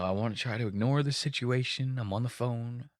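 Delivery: soft, distracted mumble; mumbled aside. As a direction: murmur it like you're half on a call. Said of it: "trying his hardest to kinda be like".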